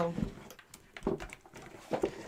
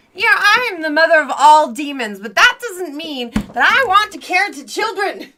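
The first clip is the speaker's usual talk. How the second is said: raised, exasperated declaring; loud exasperated protest; 3 semitones higher, 7 dB louder than usual. Pretend. Mother of all demons refusing childcare, loud exasperation. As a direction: protest it at full voice.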